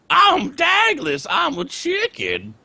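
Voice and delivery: very high, drawn-out squawking caricature; Dagless. Retold or imitated. Parodying a friend's Dagless impression, high and slow squeal. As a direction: stretch every word in an absurdly high voice. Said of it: "Well, your Dagless was".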